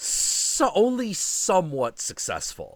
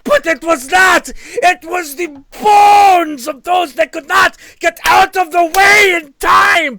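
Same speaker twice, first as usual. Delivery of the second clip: full-throated shout, high and loud, slower; dramatic shout; 14 dB louder, slower than usual. Acted. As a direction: bellow the grim ending like a horror climax. Dramatic story climax, shouted.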